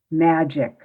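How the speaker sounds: ordinary talk, nothing marked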